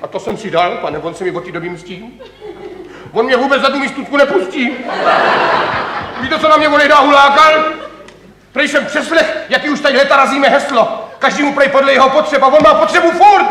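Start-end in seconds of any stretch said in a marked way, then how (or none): 3.1-13.5 high, indignant tirade; exasperated grumbling at raised pitch; worked-up complaint. Acted. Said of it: "Já se vždycky tak rozčílím, když tohleto vyprávím".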